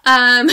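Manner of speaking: teary laugh to steady herself; emotional laugh. Real, not acted. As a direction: laugh through real emotion. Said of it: "Let me get a handle on myself".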